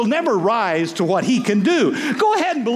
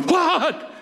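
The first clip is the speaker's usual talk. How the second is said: mock shocked; 8 semitones higher than usual. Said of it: "some of you are going".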